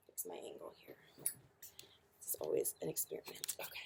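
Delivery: muttered whisper to self. Real, not acted. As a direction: whisper it as a quick aside. Muttering aside while adjusting camera.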